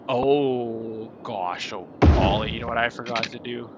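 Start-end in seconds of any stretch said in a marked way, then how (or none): none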